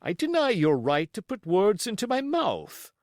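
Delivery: firm, clipped sea captain voice; stern captain. Acted. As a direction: lay down the law as captain.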